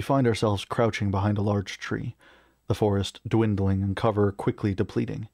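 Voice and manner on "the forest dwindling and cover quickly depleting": ordinary delivery, nothing marked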